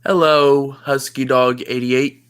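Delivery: low mocking buddy voice; deep-voiced plushie friend. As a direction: tease him dryly from way down low. Low plushie talks to chat.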